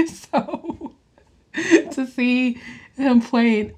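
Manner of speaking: high and slow, still laughing; laughing while talking. Real, not acted. Still laughing about Warlock while describing him, slow and high. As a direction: talk through the giggles.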